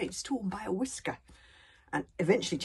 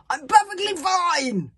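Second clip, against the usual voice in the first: high, loud, slow barking denial; barking mad; 13 semitones higher, 12 dB louder, slower than usual. A narrator's reading. Fishlegs insists wildly he is fine, barking. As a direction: insist too hard, barking. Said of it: "in a barking mad sort of way".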